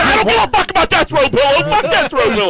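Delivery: high, loud mock rant about a pillow; mock yell. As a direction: yell it as your own outraged self. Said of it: "I was like".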